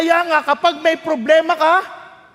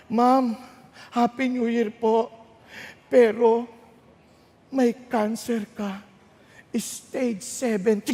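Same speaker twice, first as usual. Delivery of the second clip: lower, softer grave doctor voice; mock doctor; 5 semitones lower, 5 dB quieter, slower than usual. Pastor imitates a doctor breaking bad news softly. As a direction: break the news quietly, slowly.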